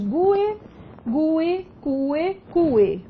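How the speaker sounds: gue, gue, cue, cue slowly as GU-e, CU-e; gue, gue, cue, cue with a long strong U before the final vowel; stressed U. Shown as a demonstration. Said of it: "a pronúncia desse U é tônica".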